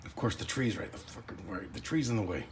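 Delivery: hushed, covert commentary; whispering. Real, not acted. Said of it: "Why am I whispering?".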